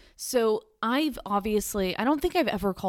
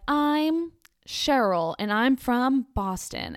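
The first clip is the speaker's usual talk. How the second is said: slow, higher caller voice, drawn out; mock caller; 5 semitones higher, 3 dB louder, much slower than usual. Vlogger imitating Cheryl calling the radio show, slow and higher. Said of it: "And they're like".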